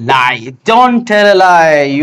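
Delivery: very slow, each word apart; slow dictation. Shown as a demonstration. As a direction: read it out slowly for learners. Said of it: "কি বলবো আমরা".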